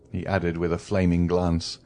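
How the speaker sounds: plain reading voice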